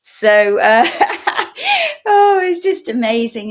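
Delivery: loud, high, laughing delivery; cracking up. Real, not acted. Host tickled by the Canadian "eh", laughing hard. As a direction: push the words out through real laughter.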